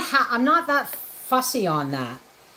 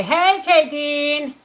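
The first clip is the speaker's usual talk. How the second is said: drawn-out call to a viewer, higher and slow; excited greeting; 5 semitones higher, 4 dB louder, much slower than usual. Real, not acted. Host greeting a viewer by name in chat, slow excited call. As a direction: stretch it out like calling across the room.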